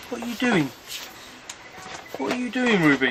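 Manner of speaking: high, soft coaxing dog-talk. Real, not acted. Owner asking his bulldog what she's doing, high soft baby-talk.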